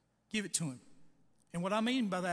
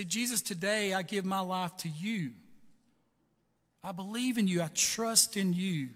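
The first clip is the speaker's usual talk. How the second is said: slow, louder prayer of surrender; model prayer; 6 dB louder than usual. Shown as a demonstration. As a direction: lead them word by word. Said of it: "And say".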